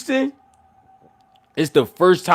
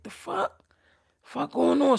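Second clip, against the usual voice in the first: surprised; 10 semitones higher, 3 dB quieter, slower than usual. Retold or imitated. Reacts to the commotion.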